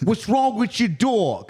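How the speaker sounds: put-on high, slow New York accent. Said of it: "That was a New York accent".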